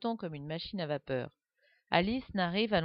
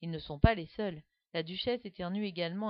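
ordinary delivery, nothing marked

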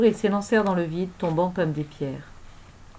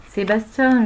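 louder call of the name; urgent yell; 3 semitones higher, 6 dB louder, slower than usual. A narrator's reading. Brunswick calls Sébastien as time runs out, urgent yell. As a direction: shout to snap someone into moving. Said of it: "hurla Brunswick".

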